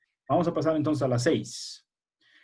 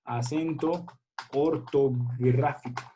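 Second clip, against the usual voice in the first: dictating slowly; much slower than usual. Shown as a demonstration. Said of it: "bueno, vamos a poner".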